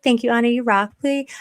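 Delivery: ordinary talk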